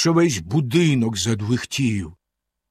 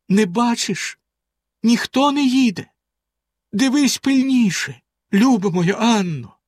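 high, anxious questioning; urgent, high-pitched plea; anxious calling; 9 semitones higher than usual. A narrator's reading. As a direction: call up to your sister for news. Doomed wife calls to lookout sister.

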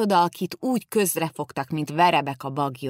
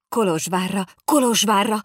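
rising, louder repeat of the city name; excited outburst; 5 semitones higher, 6 dB louder than usual. Acted. Two boys jumping up, eager to rush off. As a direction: jump up with the news. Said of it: "ugrott fel a két gyerek".

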